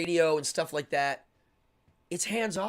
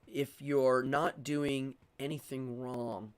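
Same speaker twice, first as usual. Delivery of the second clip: normal talking voice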